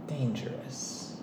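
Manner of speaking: slow, soft whisper. Real, not acted. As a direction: breathe the word out. Dramatic aside about trip.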